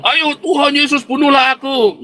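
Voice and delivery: high, loud challenging cry to Jesus; a hammed-up plea to be struck down; mock desperate prayer. Preacher mimicking someone daring Jesus to kill him.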